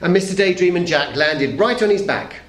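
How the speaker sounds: usual speaking voice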